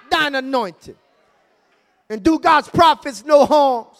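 shouted sermon over music; full-force preaching. Acted. Pastor preaches to the church, shouting over the music. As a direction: call it down in Jesus' name.

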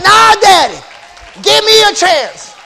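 loud, high and slowing plea; pleading shout. Acted. Jesus pleading with the Father, loud high shout. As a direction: beg Daddy for a chance at full voice.

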